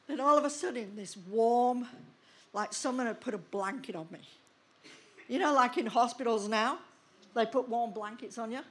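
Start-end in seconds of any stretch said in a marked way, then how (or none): none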